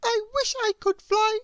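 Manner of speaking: very high little bird voice; mild sadness, high and small; tiny bird wishing sadly; wistful bird. A narrator's reading. Chirp sad.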